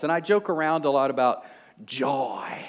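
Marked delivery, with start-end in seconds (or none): 1.9-2.6 drawn-out televangelist joy. Retold or imitated. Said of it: "my favorite little imitation of the televangelist".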